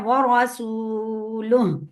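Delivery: व रसूलुहु drawn out very slowly; slow recitation. Shown as a demonstration. Teacher showing the recitation, very slow. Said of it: "आइन का ख्याल करना है".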